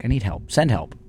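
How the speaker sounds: normal talking voice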